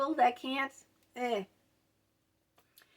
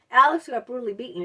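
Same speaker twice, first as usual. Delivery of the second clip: said loud; 2 semitones higher, 7 dB louder, faster than usual. Retold or imitated. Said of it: "I didn't whisper it".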